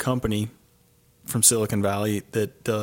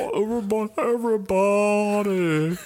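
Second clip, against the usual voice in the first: Elizabeth Holmes voice; 11 semitones higher, 3 dB louder than usual. Retold or imitated. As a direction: stretch it out in the put-on voice. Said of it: "trying to sound like her".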